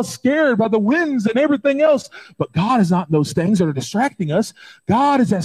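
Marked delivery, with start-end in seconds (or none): none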